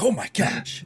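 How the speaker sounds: a high-pitched, startled oh my gosh. Retold or imitated. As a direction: react as if you've just been caught off guard.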